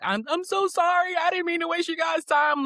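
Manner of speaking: high-pitched mock wail of apology; imitating boohoo crying. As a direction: blubber out an apology, imitating your own crying. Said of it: "I mean, boohoo crying".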